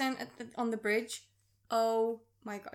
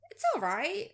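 mildly noncommittal, lifted pitch; lukewarm verdict; 6 semitones higher than usual. Retold or imitated. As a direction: give a so-so shrug of a verdict.

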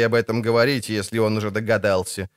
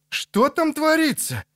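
high-pitched, drawn-out alarmed question; high anxious exclamation; alarmed cry. Acted. Alarmed onlooker straining to see.

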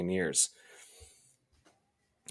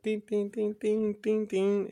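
high, bright imitated chime sound; sing-song high dings like a creature; Gremlins ding imitation; 12 semitones higher, slower than usual. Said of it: "Gremlins when they're like".

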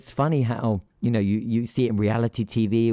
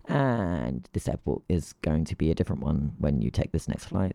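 low, offhand aside; mock low-key airline notice; airline small print; 5 semitones lower, 3 dB quieter than usual. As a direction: drop the pitch and slip it in casually. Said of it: "And yet they're like".

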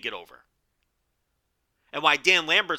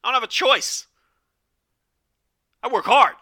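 exasperated protest; 4 dB louder than usual. Retold or imitated. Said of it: "I'm like".

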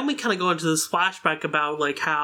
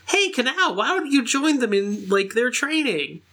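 high, lilting friendly invitation; Shinobu; 6 semitones higher than usual. Retold or imitated. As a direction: coax in a higher acted voice. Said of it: "and Shinobu's like".

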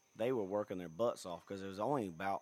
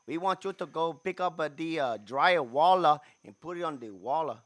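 put-on loud, higher, laboured Cuban accent; fake Cuban accent; 6 semitones higher, 11 dB louder than usual. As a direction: work hard at a bad Cuban accent. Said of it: "working really hard with this Cuban accent".

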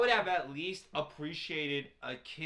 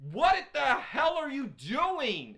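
high, drawn-out, incredulous demand; stretched-out, swooping exasperation; exasperated scolding; 7 semitones higher, 4 dB louder, slower than usual. Real, not acted. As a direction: scold him slowly in disbelief. Scolding the character.

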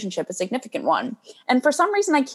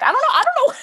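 giggly dodge; faster than usual. Real, not acted. Laughs off her own snark.

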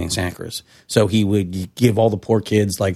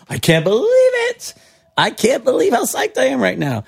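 put-on much higher, ecstatic kid voice; thrilled disbelief. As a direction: squeal it like a kid who finally got his wish. Retelling finding the perfect wetsuit.

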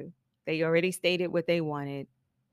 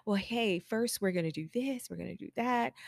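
soft, tentative walk-through; mock step-by-step explaining; 3 semitones higher, 7 dB quieter than usual. Stylist over-explaining the plan to a client, soft and tentative. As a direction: list it softly like a needless preamble. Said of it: "don't even go through the".

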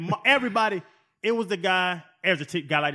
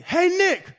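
high, bright-voiced hail to the coach; a big lineman hollering for his head coach; calling out; 12 semitones higher, 5 dB louder than usual. A veteran lineman calls out to Nick Saban, a cheerful hail.

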